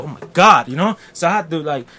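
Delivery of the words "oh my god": loud exasperated outburst; a loud fed-up exclamation. Retold or imitated. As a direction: blurt it out, fed up. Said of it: "I go".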